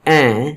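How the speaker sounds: این as a nasalised 'ain', n not sounded; nasal vowel. Shown as a demonstration. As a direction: send the vowel of این through the nose. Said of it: "مغنونہ یعنی کہ غنہ". (این میں نون غنہ)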